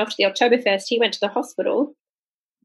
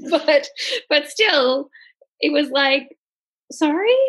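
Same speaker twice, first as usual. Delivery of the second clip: laughing; 6 semitones higher, slower than usual. Real, not acted. Laughing at the Italian sandwich place.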